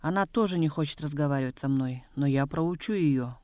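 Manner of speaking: usual speaking voice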